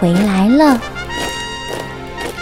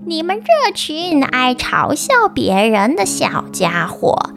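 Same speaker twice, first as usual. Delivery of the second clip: evil witch voice; slower than usual. Acted. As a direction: gloat like a wicked witch. Witch cursing the fairies.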